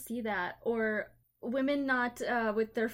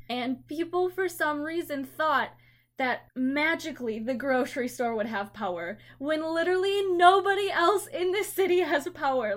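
rising louder and higher in disbelief; building frustration, almost crying out; incredulous rising rant; exasperated rant; 4 semitones higher, 7 dB louder than usual. Retold or imitated. Exasperated at customers during blackout.

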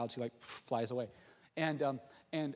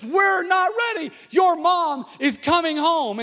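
high, loud panicked dad shout; frantic, hammed-up yell; yelling at the kids; 13 semitones higher, 13 dB louder, slower than usual. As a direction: holler it through the house in mock panic. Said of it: "he yells at the kids".

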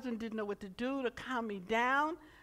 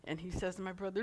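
usual speaking voice

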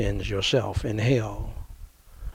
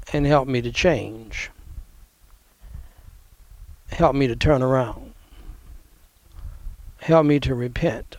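short phrases with pauses; 2 semitones higher than usual. Shown as a demonstration. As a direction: pause after each phrase to be repeated. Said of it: "Repeat after me, phrase by phrase".

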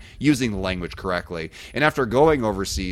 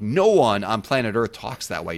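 ordinary delivery

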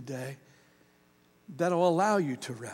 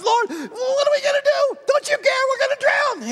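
much higher, loud, frantic shouting; panicked disciples; 7 dB louder than usual. Retold or imitated. Disciples waking Jesus in the storm, shouting in panic. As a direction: cry out in panic as the boat sinks. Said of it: "the disciples are freaking out".

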